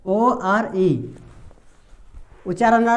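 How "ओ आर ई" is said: slow spelling, dropping. Shown as a demonstration. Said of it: "इसमें उच्चारण क्या आ रहा है?".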